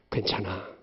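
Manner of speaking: very soft, gentle reassurance; soft parent comforting a crying child; hushed comfort. Acted. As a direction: hold a child and calm them. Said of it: "주님께서 내게 말씀하세요".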